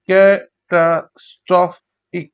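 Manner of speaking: Catastrophic slowly in four parts; syllable by syllable. Shown as a demonstration. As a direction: pause after each beat of catastrophic. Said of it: "চার ভাগে".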